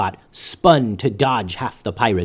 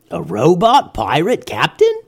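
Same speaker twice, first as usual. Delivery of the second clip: curious pirate; 5 semitones higher than usual. A narrator's reading. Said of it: "thought one pirate out loud".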